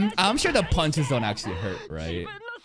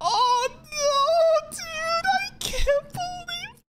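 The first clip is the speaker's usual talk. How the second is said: very high, drawn-out squeal of disbelief; slow, squeaky near-cry; high-pitched disbelief; much slower than usual. Real, not acted. Viewer can't believe he's freezing, squealing in disbelief.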